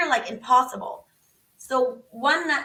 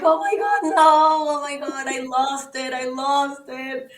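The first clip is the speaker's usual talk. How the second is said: retold panicked dismay; 2 semitones higher, 4 dB louder than usual. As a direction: wail it, then let it fall away. Call drops, she acts out panic.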